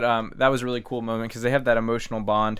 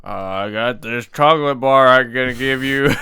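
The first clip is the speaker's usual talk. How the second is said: slow, louder put-on Raccoon voice; Raccoon impression; 8 dB louder than usual. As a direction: drawl it out like the goofy alien Raccoon. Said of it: "Raccoon impression real quick".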